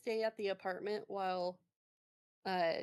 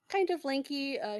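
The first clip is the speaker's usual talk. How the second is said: ordinary talk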